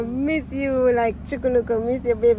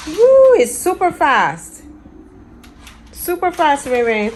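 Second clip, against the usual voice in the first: excited cheer; 6 semitones higher, 7 dB louder than usual. Real, not acted. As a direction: cheer her on as she drives. Cheering toy car ride.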